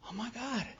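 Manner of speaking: high, hushed amazed realisation; dawning delight. Retold or imitated. Sponsee realising his progress, softly amazed. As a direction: marvel at sudden good news. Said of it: "I got a grin from here to here".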